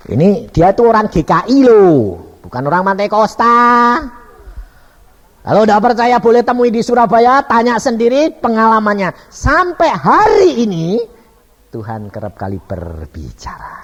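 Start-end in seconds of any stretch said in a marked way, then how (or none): 0.1-11.0 loud, raised, emphatic challenge; full-force preaching. Real, not acted. Preacher dares doubters to check the testimony, loud and high. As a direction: drive it at the doubters.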